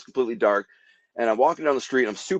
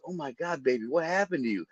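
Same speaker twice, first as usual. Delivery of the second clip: softer, caring neighbor lady voice; concerned lady; 4 semitones higher, 8 dB quieter than usual. Retold or imitated. Neighbor lady sees the bloody stranger, softly concerned. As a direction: coo it gently like a worried neighbor. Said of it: "She goes".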